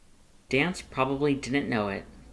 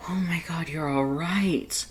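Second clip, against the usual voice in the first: higher, gasping relief, slow on right; relieved gasp; 6 semitones higher than usual. A narrator's reading. Grilby finds his missing friend, gasping in relief. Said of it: "Grilby gasped".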